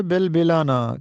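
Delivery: short i after both b's in بلبلانا; bilbilana pronunciation. Shown as a demonstration. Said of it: "کسی تکلیف کی وجہ سے رونا". (بلبلانا کو زیر کے ساتھ "بِلبِلانا" پڑھیں)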